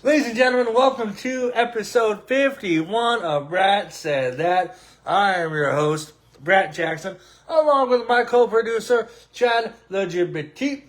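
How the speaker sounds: booming podcast host welcome; show host intro. Acted. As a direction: open the show like an arena announcer, high and loud.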